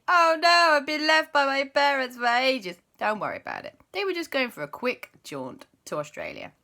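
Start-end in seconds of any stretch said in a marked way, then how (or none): 0.1-2.8 mock worried child. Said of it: "before you start thinking".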